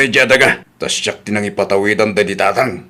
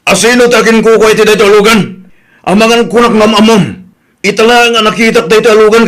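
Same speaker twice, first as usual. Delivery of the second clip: loud, high furious demand; angry shout; 11 semitones higher, 6 dB louder than usual. Acted. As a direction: bark the question at them, fuming. Angry father demands who parked. (nakapungtot nga ikkis)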